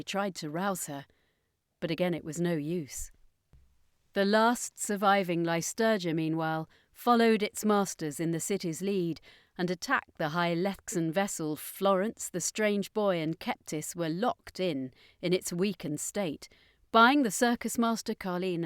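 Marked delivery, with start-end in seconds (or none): none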